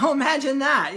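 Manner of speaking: sarcastic. Retold or imitated. Sarcastic retort to the seller.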